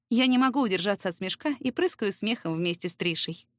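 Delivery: plain reading voice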